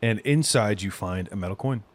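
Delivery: usual speaking voice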